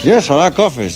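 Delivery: acted patient who can't open his mouth; mouth wired shut. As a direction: answer through clenched teeth. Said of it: "His mouth was like this".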